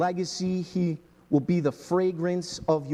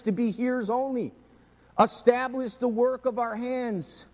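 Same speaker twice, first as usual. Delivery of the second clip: higher, louder fervent preaching; building preaching; 7 semitones higher, 3 dB louder than usual. Acted. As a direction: preach it out with rising fire. Preaching, rising intensity.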